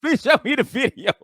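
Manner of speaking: high, laughing plea; mock pleading. Paramedic begging for the accident video, laughing mock plea. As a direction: beg in a high giggly voice.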